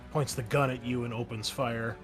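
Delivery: ordinary talk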